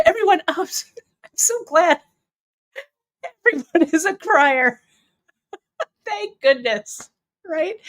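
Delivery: high, laughing through the words; laughing relief. Real, not acted. Host laughs, relieved viewers are criers too.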